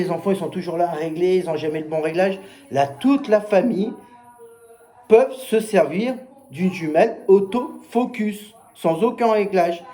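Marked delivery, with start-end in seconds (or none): 2.7-9.8 slowed, higher, weighty selling line; slow down and lean on each word; drawn-out salesman emphasis; slow emphatic pitch. Retold or imitated. Salesman stressing product benefit.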